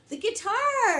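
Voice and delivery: high, loud cheer of the answer; excited reveal. Real, not acted. Teacher revealing the answer to the class, excited. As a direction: announce it with delight. Answering her own quiz.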